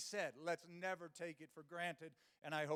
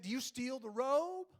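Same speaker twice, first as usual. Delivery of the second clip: emphatic mock question; 6 semitones higher, 9 dB louder than usual. Mocking hotel guests' habits.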